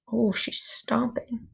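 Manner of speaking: usual speaking voice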